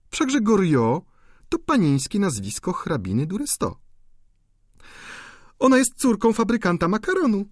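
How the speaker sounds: amused viscountess. A narrator's reading. Viscountess laughingly explains Eugene to the duchess, teasing. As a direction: tease the duchess about him.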